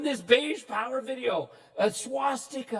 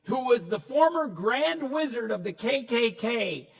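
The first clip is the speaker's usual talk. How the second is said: ordinary talk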